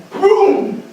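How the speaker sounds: high, loud burst; sudden exclamation. Acted. [name] retelling what he said to Lydia, explosive high outburst. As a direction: explode on the single word.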